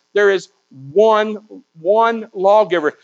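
"one" drawn out and set apart by a pause; measured reading that isolates "one"; stress on ONE. Shown as a demonstration. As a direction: lean hard on "one", then repeat it. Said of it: "the emphasis is on the word one".

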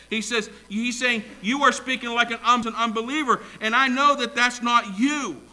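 high, loud, insistent sermon voice; emphatic preaching. Real, not acted. Preacher explaining Job's words to congregation, emphatic.

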